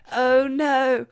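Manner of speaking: put-on high, loud, dismayed old-lady-like voice; Shallard's voice. Demonstrating what Shallard sounds like, high character voice. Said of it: "that's what Shallard sounds like".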